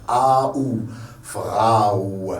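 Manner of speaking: "Frau" with A gliding into OU; both vowels sounded. Shown as a demonstration. Teacher demonstrating every letter of the word, both vowels sounded. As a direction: stretch "Frau" to FRA-OU. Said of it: "prononcez toutes les lettres".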